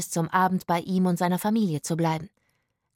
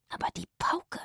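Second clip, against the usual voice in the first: higher, hushed whisper; 5 semitones higher, 6 dB quieter than usual. A narrator's reading. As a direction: whisper the objection urgently. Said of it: "flüsterte Zora".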